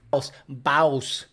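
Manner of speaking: "boss" said as "baus"; local pronunciation. Shown as a demonstration. Showing how Houston says boss, exaggerated "baus". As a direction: stretch boss into a drawn "baus". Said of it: "You don't say boss".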